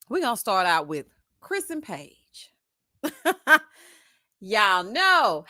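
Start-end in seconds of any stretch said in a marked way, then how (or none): 3.0-3.6 short loud real laugh. Real, not acted. Host amused by Chris and Paige, loud laugh. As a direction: burst out with a quick cackle.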